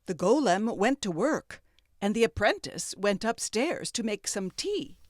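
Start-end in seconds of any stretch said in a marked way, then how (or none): none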